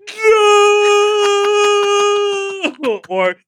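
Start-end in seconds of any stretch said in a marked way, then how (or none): 0.1-3.0 high loud wailing no; hammed-up scream of no; mock terrified cry. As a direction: cry out as if chased by a clown. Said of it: "camera catch you being like".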